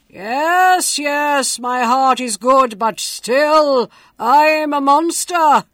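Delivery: loud, booming beast voice; grotesque monster voice. A narrator's reading. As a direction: boom it out like a monster. The monster speaks.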